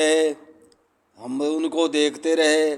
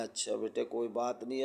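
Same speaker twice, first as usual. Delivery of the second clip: soft, hushed comfort, much softer; gentle consoling; 4 semitones lower, 12 dB quieter than usual. Retold or imitated. Uncle softly comforting the crying girl at his shop, hushed and tender. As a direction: ease her tears quietly.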